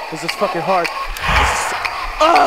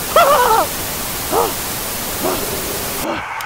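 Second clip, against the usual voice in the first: nervous laugh; 12 semitones higher than usual. Real, not acted.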